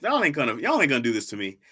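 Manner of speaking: ordinary talk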